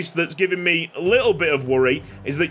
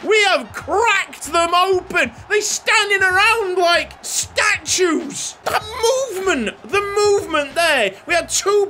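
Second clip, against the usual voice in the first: excited goal call; 10 semitones higher than usual. Real, not acted. Player celebrating Ipswich cutting Spurs open, excited roar. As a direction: shout it like the goal of the season. Aubert scores, commentator celebrates.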